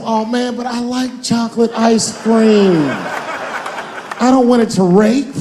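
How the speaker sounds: high, slow, pleading denial; a mock wounded fan; whiny denial. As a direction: whine it like a heartbroken fan. Imagining fans in denial.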